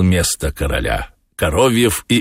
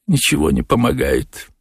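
lightly raised character voice; Коровьев; 6 semitones higher than usual. A narrator's reading. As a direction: remark it in a higher voice. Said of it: "заметил Коровьев". (чуть тоньше обычного голоса)

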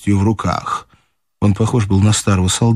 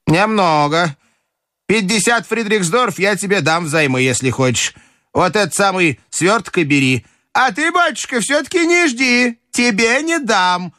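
high grandmother, climbing higher on the refusal; властная бабушка; 11 semitones higher than usual. Acted. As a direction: offer high, then refuse even higher. Grandmother offers loan, refuses the general. (высокий властный голос бабушки)

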